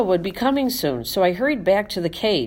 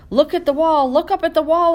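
high, louder squeak; 8 semitones higher, 4 dB louder than usual. Acted. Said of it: "I squeaked".

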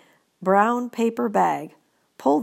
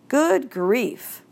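exasperated, higher exclamation; fed-up teacher finding a messy table; very unhappy; 6 semitones higher than usual. Acted. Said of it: "in a very unhappy voice".

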